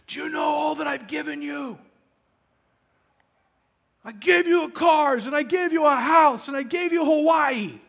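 retold husband's angry tirade; berating husband. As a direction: bark it at her like a rude husband. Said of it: "he just berates her".

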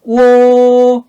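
오 held high, loud and level; high first tone. Shown as a demonstration. Teacher drilling sounds one by one, 오 high and level. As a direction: sustain 오 on one high note. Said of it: "하나하나씩".

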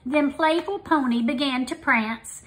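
ordinary delivery

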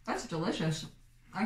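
speaking between bites; talking while chewing. Real, not acted. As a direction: mouth partly full of snack. Seen 0: Woman ate snack just before; cheek bulges, chewing while speaking early on.

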